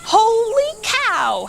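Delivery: high, slow cook's holler. A narrator's reading. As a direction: holler it out like a startled cook. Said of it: "hollered Manny the cook".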